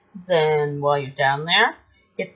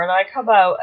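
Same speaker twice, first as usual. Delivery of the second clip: usual speaking voice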